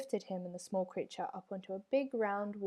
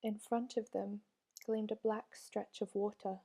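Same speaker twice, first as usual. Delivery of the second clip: usual speaking voice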